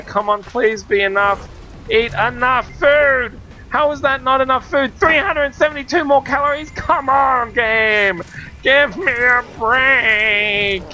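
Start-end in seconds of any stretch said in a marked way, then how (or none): 1.9-10.8 higher-pitched, incredulous protest; exasperated. Real, not acted. Gamer short of calories, exasperated high complaint. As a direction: plead with the game, stretching the final words.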